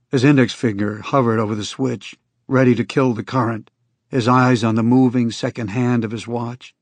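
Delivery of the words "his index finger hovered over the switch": ordinary delivery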